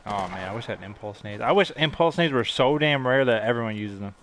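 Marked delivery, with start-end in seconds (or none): none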